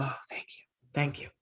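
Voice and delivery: hushed, breathless whisper of gratitude; whispered thanks. Retold or imitated. Mother retelling her grateful reply to her son, whispered. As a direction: whisper it like a small private prayer. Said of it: "I go".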